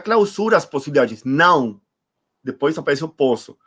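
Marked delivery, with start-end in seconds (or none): none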